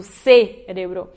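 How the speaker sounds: "cérebro" with a slow, punched SE; stress on first syllable. Shown as a demonstration. Teacher points to the accented E, "cérebro" stressed on SE. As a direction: isolate the SE that starts "cérebro". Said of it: "teriam acentinho no E".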